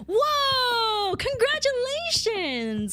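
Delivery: high, drawn-out squeal of delight; thrilled congratulations. Real, not acted. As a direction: gush slowly and high, overjoyed for them.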